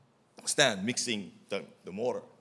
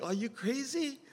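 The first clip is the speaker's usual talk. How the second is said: incredulous. Retold or imitated. Asking the director about brick, high incredulous. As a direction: shoot the pitch up in disbelief. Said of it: "I was like".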